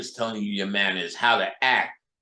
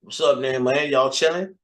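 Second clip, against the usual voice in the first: light Southern greeting, pitched up; Southern accent; 3 semitones higher than usual. Retold or imitated. Greeting friends after picking up the accent, light Southern accent. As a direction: greet like you grew up in Atlanta. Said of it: "I be finding myself doing the accent".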